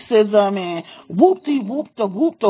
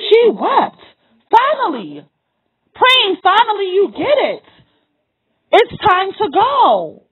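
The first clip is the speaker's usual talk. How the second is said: raised, cracking voice full of tears; tearful, high; 7 semitones higher than usual. Real, not acted. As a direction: let the tears lift the voice high. Emotional peak, crying high.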